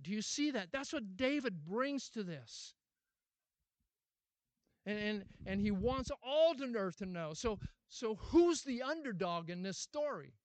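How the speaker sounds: pastor presses the congregation on David's faith, then fervent preaching; preaching at full force. Acted. Drive each question home from the pulpit.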